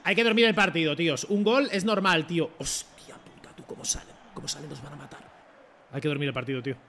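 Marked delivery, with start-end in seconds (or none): 2.6-5.2 hushed, voiceless dread; alarmed whisper. Real, not acted. Player watching the rivals break out, alarmed whisper. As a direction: whisper it as if danger is closing in.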